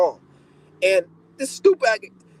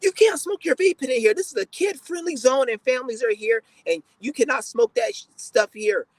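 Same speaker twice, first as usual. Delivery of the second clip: mocking the staff woman; 7 semitones higher than usual. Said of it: "The bitch came up to me".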